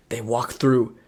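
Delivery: normal talking voice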